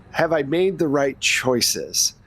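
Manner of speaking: ordinary delivery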